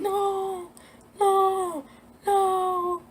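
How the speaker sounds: high, drawn-out wail of denial; imitated crying. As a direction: re-enact a tearful, high-pitched wail. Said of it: "no, I was crying".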